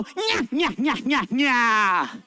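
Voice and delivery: evil witch cackle. Shown as a demonstration. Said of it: "And everyone go".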